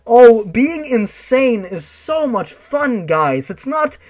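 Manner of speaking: loud, high, drawn-out sarcasm; sarcastically telling the guys madness is fun; sarcastic mock glee. Real, not acted. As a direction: ham up how fun insanity is. Sarcastic rant to listeners.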